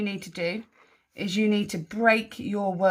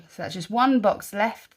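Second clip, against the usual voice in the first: ordinary talk, nothing marked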